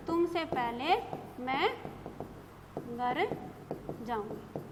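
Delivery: words spaced out with pauses; slow dictation, one word at a time. Shown as a demonstration. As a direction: dictate it slowly to the class. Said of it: "तुमसे पहले घर में जाऊंगी".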